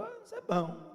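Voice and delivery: high, airy "isso é bom"; feigned casual approval. Retold or imitated. Son hiding his joy from his mother, high and airy. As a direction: act unbothered, lift it up high.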